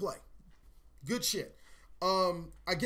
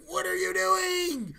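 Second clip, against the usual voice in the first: much higher, slow, drawn-out what are you doing; exaggerated disbelief; 12 semitones higher, 4 dB louder, slower than usual. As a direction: ask your own shoulder in stretched, high disbelief. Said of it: "I'm looking at my shoulder like".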